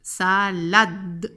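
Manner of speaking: "salad-d", D released clearly; emphasised final D. Shown as a demonstration. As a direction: split "Salade" and land crisply on the D. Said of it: "le phonème D".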